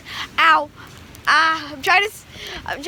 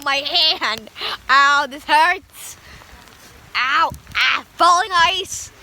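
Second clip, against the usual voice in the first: normal talking voice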